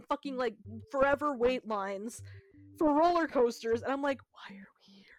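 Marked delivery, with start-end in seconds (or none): 4.3-5.0 hushed unvoiced whisper. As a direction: breathe it under your breath. Retold exasperation in line.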